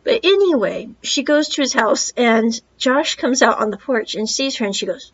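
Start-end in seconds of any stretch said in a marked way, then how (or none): none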